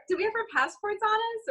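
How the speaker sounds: ordinary talk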